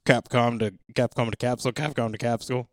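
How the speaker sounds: loud mission control radio call; breaking up space radio. Pretend. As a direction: bark it like crackly capsule radio. Mock space mission radio chatter.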